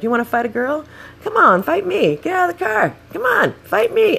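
high-pitched goading mimicry; imitated fight challenge. Bike-riding locals challenging the driver, imitated high goading. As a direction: act out daring someone to step out and fight.

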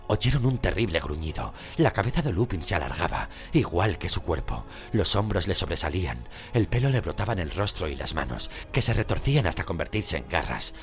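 ordinary delivery, nothing marked

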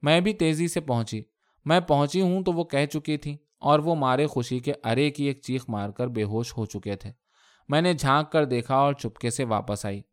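plain reading voice

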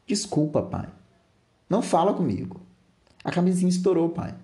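high acted pleading with a touch of tears; tearful pleading. Daughter apologizing to her father, mildly tearful. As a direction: plead upward, lightly imitating a crying girl. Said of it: "ela tá chorando nesse momento".

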